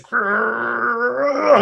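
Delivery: high-pitched growling snarl; mock growl. Bam growling at him before a hug, hammed-up snarl. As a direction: growl like an angry beast. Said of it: "he's like".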